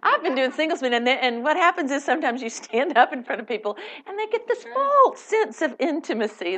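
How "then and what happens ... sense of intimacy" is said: normal talking voice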